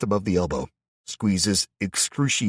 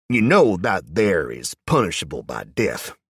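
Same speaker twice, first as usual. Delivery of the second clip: a lightly voiced Southern twang, pushed out louder; thick southern accent; 6 dB louder than usual. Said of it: "in a thick southern accent, almost a twang".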